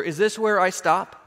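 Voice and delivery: ordinary delivery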